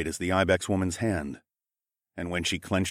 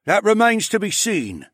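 higher clipped officer line; high major voice; 13 semitones higher, 5 dB louder than usual. Acted. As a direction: answer coolly as an officer. Major answers his second.

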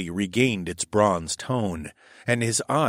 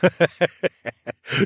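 high scornful laugh; mocking laugh; 9 semitones higher, 3 dB louder than usual. A narrator's reading. The cheetah laughs at the narrator, mocking. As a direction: laugh in his captor's face. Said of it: "he laughed".

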